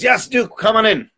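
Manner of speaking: high, hearty invitation; high-pitched acted welcome; welcoming host. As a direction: call the duke inside eagerly. Third host welcomes the duke.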